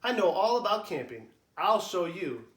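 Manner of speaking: put-on bright, boastful child voice; proud little boast. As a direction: show off to dad, sure of yourself. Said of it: "he continues proudly".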